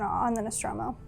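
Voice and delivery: normal talking voice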